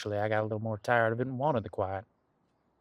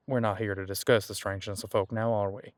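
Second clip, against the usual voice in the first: usual speaking voice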